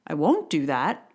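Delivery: ordinary talk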